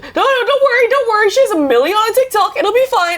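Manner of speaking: high, hurried reassuring; frantic reassurance. Retold or imitated. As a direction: rush to smooth it over. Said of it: "She's like".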